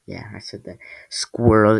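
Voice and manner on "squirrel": "Squirrel" loud, as "skwor-ul"; mispronounced squirrel. Shown as a demonstration. Reader mocks own slip, "Squirrel" said as "skwor-ul". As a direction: say "Squirrel" with an OR vowel, loudly. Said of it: "I said, it said squirrel".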